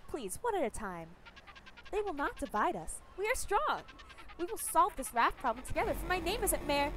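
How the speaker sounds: lightly voiced mayor rallying the town; Ghost. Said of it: "How do I do a ghost impression?".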